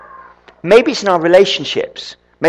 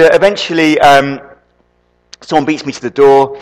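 ordinary delivery

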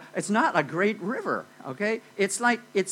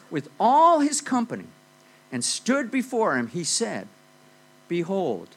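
solemn Bible reading, starting loud then settling; scripture reading; 4 dB louder than usual. Acted. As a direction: read the verse like a proclamation. Reads Second Kings aloud.

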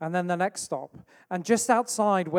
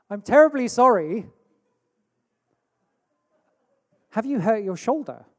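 higher and louder, terribly proper politeness; best polite voice; 4 semitones higher, 6 dB louder than usual. Retold or imitated. Preacher addressing a stranger on the bus, in his best polite voice. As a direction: put on your most polite English manners. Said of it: "in my best voice".

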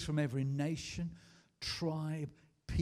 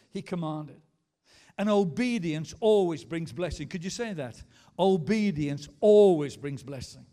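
loud, emphatic preacher proclamation; full-force preaching; 4 semitones higher, 9 dB louder than usual. Real, not acted. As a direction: drive each phrase home to the congregation.

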